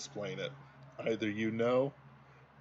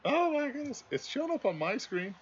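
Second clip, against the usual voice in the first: surprised squeal. Real, not acted. Meme appears on his screen, surprised high yelp. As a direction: pitch shoots way up in surprise.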